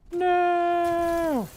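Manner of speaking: high, loud yell; raging gamer. Real, not acted. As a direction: scream it as the game slips away. Enemy about to capture.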